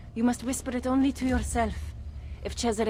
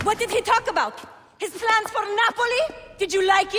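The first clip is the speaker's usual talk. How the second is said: jealous interrogation; 11 semitones higher, 4 dB louder than usual. Acted.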